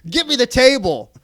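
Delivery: a mock wrestler's roaring command; shouted demand. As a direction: bellow it at the ring announcer. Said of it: "he says".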